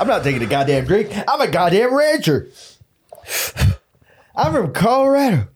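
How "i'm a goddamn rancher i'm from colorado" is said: hammed-up macho rancher voice; playing the tough cowboy, pitched up; tough rancher character. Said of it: "you gotta stay in character".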